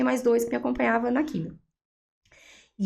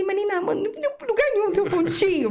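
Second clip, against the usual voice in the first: pushing the doctor for certainty, pitched high; high disbelieving question; 10 semitones higher than usual. Retold or imitated. Patient pressing doctor about any remaining spot, high disbelief. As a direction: voice jumps up, can't believe it.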